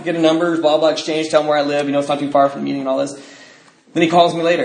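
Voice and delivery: ordinary talk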